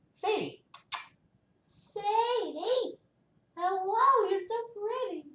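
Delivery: tiny high, soft coo. Real, not acted. Reader greeting pretty Sadie, soft high cooing.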